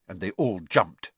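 usual speaking voice

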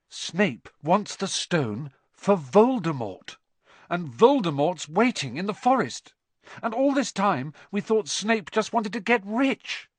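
put-on agitated boy, high and rising; Harry. A narrator's reading. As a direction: pour out an alarming discovery, building as you go.

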